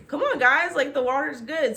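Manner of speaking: high, loud, gleeful shout; excited call. Retold or imitated. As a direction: call them in from the water, thrilled. Octavia calls from the lake.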